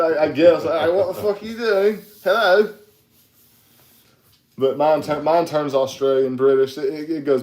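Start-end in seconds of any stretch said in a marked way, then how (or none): 0.6-2.7 higher-pitched boozy English accent; slangy drunk Englishman; tipsy English accent, pitched up. Shown as a demonstration. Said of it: "my drunk English accent".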